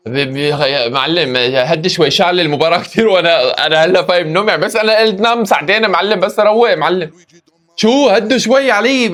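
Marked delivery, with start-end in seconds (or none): none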